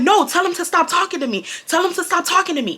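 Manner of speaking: high-pitched, insistent complaint; indignant plea, quickening on the repeat; heated protest. Retold or imitated. Girl protesting to the teacher about Brian, heated.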